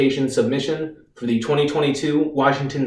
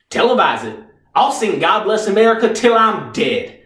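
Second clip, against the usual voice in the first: higher-pitched, declaiming soldier on trial; defiant defendant; 4 semitones higher than usual. Acted. Reeves rehearses his act for the lawyer, defiant and declaiming. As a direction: proclaim it as a grand, defiant act.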